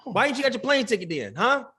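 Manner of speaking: high-pitched, indignant grilling; mock interrogation. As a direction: press him for proof, playfully offended.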